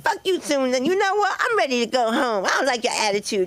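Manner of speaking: indignant cousin. Acted. Cousin telling her off before going home, mock indignant. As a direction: fire back with hammed-up sass, high. Cousin's retold angry quarrel.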